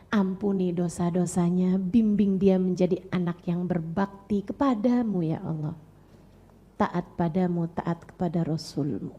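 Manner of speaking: put-on a calm mother's prayer for her child; gentle prayer.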